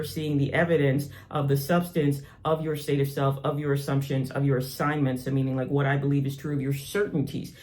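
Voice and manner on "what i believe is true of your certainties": ordinary talk, nothing marked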